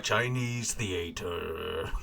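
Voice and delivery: low, drawn-out thespian voice; a hint of hammy stage actor, slow; mock theatrical. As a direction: stretch it out low, teasing the impression. Riffing on the Mandarin impression.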